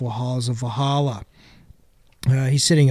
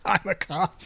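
sarcastic; 4 semitones higher, 5 dB quieter than usual. Pretend. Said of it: "Have you seen this duster?".

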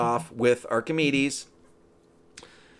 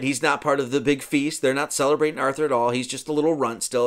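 ordinary delivery, nothing marked

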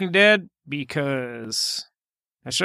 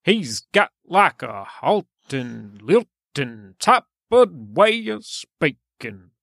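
very slow, halting, lilting delivery; slow stop-start mock lilt; 5 semitones lower, slower than usual. Shown as a demonstration. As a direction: break it up word by word with a lilt. Imitating the halting, lilting speech described.

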